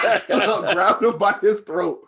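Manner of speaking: laughing loudly through the words. Real, not acted. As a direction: burst out laughing mid-sentence. Laughing while telling the fight.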